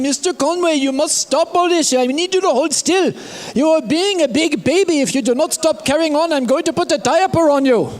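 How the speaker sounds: a hint of a stern doctor. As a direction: lightly scold him like a big baby. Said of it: "he's saying".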